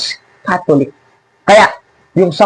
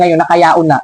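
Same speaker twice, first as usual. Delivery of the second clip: ordinary delivery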